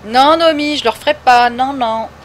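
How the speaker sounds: emphatic no to the chat; loud refusal. Real, not acted. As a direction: shut the request down loudly. Refusing a viewer's request again.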